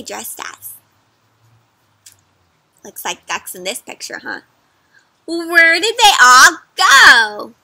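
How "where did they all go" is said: loud, slow witch yell; witch shouting. Acted. Witch shouting to a duck, loud and slow. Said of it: "Grit shouted to a duck".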